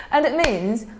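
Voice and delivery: normal talking voice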